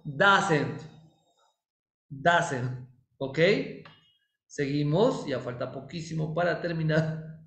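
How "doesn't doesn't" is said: Doesn't said as "DUZ-nt", stress on the first syllable, falling; model pronunciation. Shown as a demonstration. Teacher to the class, careful demo of Doesn't. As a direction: spell out Doesn't slowly for the class, falling at the end. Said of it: "escuchen bien esa pronunciación".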